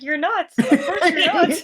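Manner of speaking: high and loud, breaking into laughter; laughing mock reassurance. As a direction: tease her through a laugh. Acting out a teasing reply.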